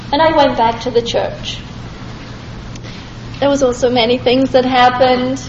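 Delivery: usual speaking voice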